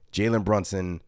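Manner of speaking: normal talking voice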